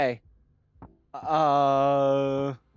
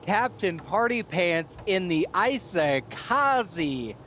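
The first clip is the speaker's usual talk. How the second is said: mock announcer; 4 semitones higher, slower than usual. Announcing her own victory.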